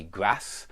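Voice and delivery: grass with a flat a; short a in grass; northern grass. Shown as a demonstration. Teacher quoting the northern grass. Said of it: "they certainly do say".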